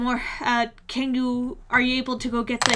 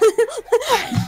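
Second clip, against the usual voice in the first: squeaky high; 11 semitones higher, 5 dB louder than usual. Acted. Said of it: "I like that you make that distinction".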